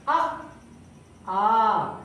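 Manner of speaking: short a then held aa; short then long vowel. Shown as a demonstration. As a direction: say आ quick, then hold the second आ. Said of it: "इसमें क्या हुआ? कम समय". (छोटा और लंबा उच्चारण)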